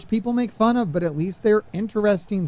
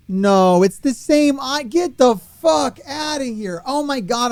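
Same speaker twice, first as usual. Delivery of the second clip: higher and louder, real anger boiling over; furious outburst; 6 semitones higher, 4 dB louder than usual. Real, not acted. Streamer raging at the game over duplicate icons, shouting. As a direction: explode at the rip-off. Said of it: "I'm so pissed right now".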